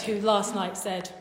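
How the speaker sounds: ordinary talk, nothing marked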